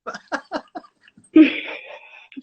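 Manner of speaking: bursting into laughter mid-cheer; loud laughing encouragement. Real, not acted. Laughs while cheering her on.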